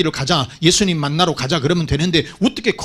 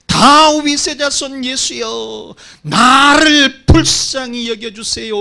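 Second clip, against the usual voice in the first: higher, slow, tearful cry for mercy; crying out loud; 7 semitones higher, 3 dB louder, slower than usual. Retold or imitated. Blind beggar crying out to Jesus for mercy, high and tearful. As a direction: cry out at full voice, begging. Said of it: "크게 소리를 질러서".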